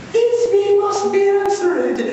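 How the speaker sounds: very high, loud mocking falsetto; hammed-up shrieky impression of an amateur player; mock stage mimicry. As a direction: squeal it out like a ham actor. Puck mimics the line being rehearsed.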